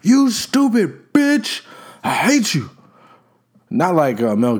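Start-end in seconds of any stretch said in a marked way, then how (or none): 0.0-2.7 pitched way up, slow, hammed-up hateful snap; mock rage, high and drawn out, like a ranting madman; mock angry outburst. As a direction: play a cartoonishly furious man spitting insults, half-joking. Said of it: "But never out of anger".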